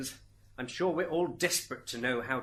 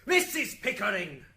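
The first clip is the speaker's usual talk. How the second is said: loud, high warning call; stern rebuke; 7 semitones higher, 7 dB louder than usual. Acted. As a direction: slap her down by name. Judge reprimands interrupting witness.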